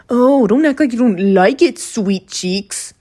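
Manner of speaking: loud, high mock-teasing voice easing off at the end; Jax impression. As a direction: taunt it high and loud, then drop back. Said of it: "he outright says".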